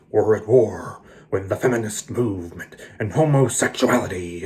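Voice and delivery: mock a Baptist minister mixed with a dark villain voice; Jerry Falwell Senior. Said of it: "no one talks like that".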